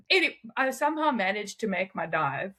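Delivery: ordinary talk, nothing marked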